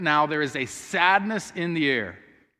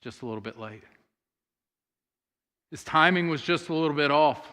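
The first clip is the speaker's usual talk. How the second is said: 1 disappointed sigh; 2 semitones lower than usual. Retold or imitated. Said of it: "all they can think is".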